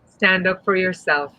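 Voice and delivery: normal talking voice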